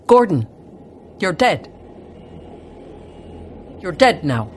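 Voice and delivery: loud, firm shouted instructions; yelling. A narrator's reading. Lamas shout into a dead man's ear, yelling. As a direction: call it out forcefully, in short bursts. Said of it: "And they yelled".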